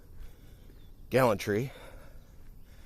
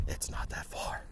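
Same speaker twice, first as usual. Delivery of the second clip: breathy hushed whisper; 6 semitones lower, 9 dB quieter than usual. Real, not acted. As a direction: let it fall to a whisper. Hushed aside while walking.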